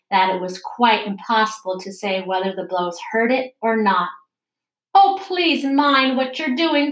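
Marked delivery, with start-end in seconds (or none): 4.9-6.9 panicked, slow pleading cry; terrified cry. A narrator's reading. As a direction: beg them to stop in terror. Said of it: "cried Alice ... agony of terror".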